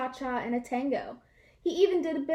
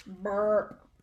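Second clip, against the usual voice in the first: low, contented burp; lazy dog burp; 5 semitones lower than usual. A narrator's reading. Full dog burps at his people, low burp. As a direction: let out a satisfied burp. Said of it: "said Stanley".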